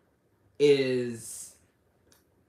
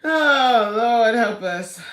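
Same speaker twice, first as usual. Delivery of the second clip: loud, slow oh Lord plea; exasperated cry; 7 semitones higher, 12 dB louder, much slower than usual. Real, not acted. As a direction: cry out to the heavens in mock despair. Exasperated outburst after laughing.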